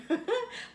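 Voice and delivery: sudden high giggle at a memory; fond remembering laugh; high burst of laughter. Real, not acted. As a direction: laugh out, pitch jumping up.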